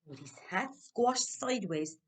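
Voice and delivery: usual speaking voice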